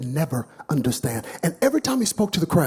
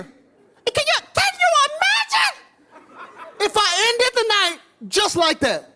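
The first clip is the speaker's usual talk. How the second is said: laughing disbelief; slower than usual. Real, not acted. Laughing at the idea.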